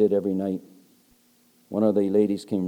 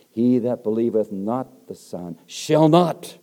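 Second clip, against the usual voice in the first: both not words weighted, slow and louder; stress on not; 6 dB louder, slower than usual. Shown as a demonstration. Preacher stressing the double not in the verse. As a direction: lean on each not, slowly. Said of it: "It's to put emphasis on it".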